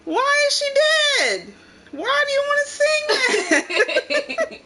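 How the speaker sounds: high, loud incredulous uncle, laughing; a horrified grown-up refusing the song; appalled uncle, slowing at the end; Uncle Tony, appalled. Retold or imitated. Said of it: "He's like".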